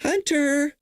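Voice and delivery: high, slow mock old man calling Hunter; a high quavering Biden impression. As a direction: call out like a doddering old president. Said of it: "Let me do the Biden voice".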